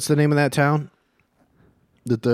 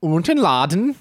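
higher-pitched attempt at a Swedish accent; badly faked Swedish accent; 6 semitones higher, 3 dB louder than usual.

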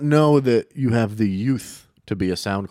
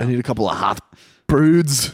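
put-on Irish playwright accent; hammed-up Irish. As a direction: attempt a broad Irish accent. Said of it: "I gotta work on that accent".

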